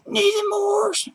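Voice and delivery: very high, drawn-out squeal; squealed greeting. Pretend. Greeting missed friend Nathan Morse, squealed. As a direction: stretch the name out in a tiny high voice.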